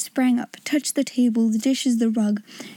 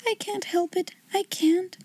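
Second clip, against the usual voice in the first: put-on high, soft, mournful young girl; a softly voiced girl's mournful sigh; 7 semitones higher, 4 dB quieter than usual. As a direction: plead it quietly, a little girl giving up. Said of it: "she said mournfully".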